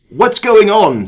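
alarmed British doctor. Acted. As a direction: call out a startled question.

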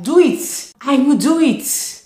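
high, loud and drawn out, fired up; excited shout. Retold or imitated. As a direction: belt it like a divine command. God urging her on.

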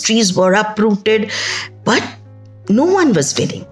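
plain reading voice

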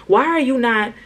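usual speaking voice

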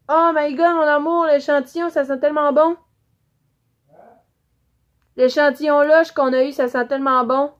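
loud, high, thrilled gush over a lovely smell; excited gushing. Real, not acted. Vloggeuse qui s'extasie devant son échantillon, cri de joie aigu et fort. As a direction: squeal with delight at the scent.

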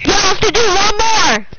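loud, high shouted instruction; yelling into the mic; screaming. Real, not acted. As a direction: shout it as if they can't hear. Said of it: "you should stop screaming".

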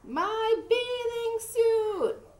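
high, slow, drawn-out wail; hammed-up embarrassed howl; dismayed cry. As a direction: stretch out each word in mock horror. Froggy reveals lost suit, yelling.

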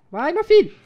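loud high cheer; excited shout. Real, not acted. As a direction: yell him on, thrilled. Cheering teammate on.

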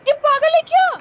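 very high-pitched, exasperated protest; outraged protest. Real, not acted. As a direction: demand an explanation in disbelief. Outraged at stolen kill. (झल्लाकर ऊँची आवाज़ में शिकायत)

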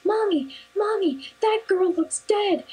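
put-on high, childlike and alarmed; little boy's voice. Little boy calling to his mom in a high, worried child voice. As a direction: play a small kid tugging at mom. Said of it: "looked up to his mom and goes".

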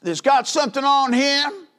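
high, loud hammering cry; preaching at full force. Acted. Preacher crying out to the church at full force. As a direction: shout it from the pulpit.

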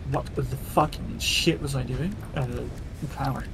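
ordinary talk

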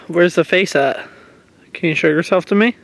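usual speaking voice

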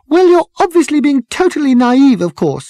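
put-on high, loud, strident girl's voice. A narrator's reading. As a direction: cut in stridently, pitched right up. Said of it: "the girl with the strident voice".

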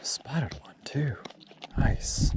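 breathy whispered remark; fishing whisper. Real, not acted. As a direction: whisper it so no one hears. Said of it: "I'm trying to be quiet".

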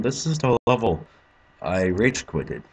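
ordinary delivery

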